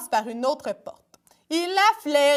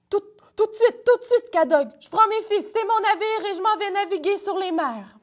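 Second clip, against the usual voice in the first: higher, hurried nervous pirate; timid brother pirate; 8 semitones higher, faster than usual. Acted. Arzel answers his fierce brother, higher and nervous. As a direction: stammer it out, eager to flee.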